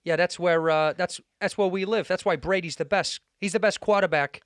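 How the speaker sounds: improvised Boston sports-fan talk; Boston accent. Acted. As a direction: drop the R's like a Boston guy. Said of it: "say if you're a Bo-, from Boston".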